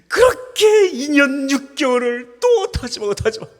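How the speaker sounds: high, loud preacher's cry building up; impassioned preaching. Acted. Pastor crying out to the congregation, high and loud. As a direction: pour out the ban years like a lament.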